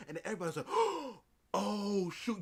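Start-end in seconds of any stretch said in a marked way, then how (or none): 0.7-2.4 loud, high gasp into an exclamation; hammed-up crowd gasp; mock shock. Everybody hearing the past brought up, loud mock-shocked gasp.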